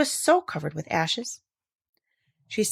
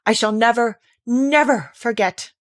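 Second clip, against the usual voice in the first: horrified vow; 3 semitones higher, 5 dB louder than usual. Acted. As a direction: swear it with remembered horror. King recalling horror.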